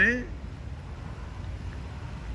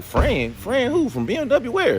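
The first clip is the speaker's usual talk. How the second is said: much higher, baffled retold questions; mock surprise; 12 semitones higher, slower than usual. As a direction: play up the confusion. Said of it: "I was like".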